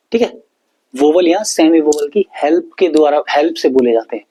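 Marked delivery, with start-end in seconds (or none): none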